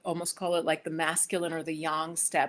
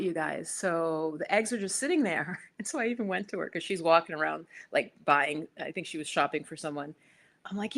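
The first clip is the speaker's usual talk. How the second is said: ordinary talk, nothing marked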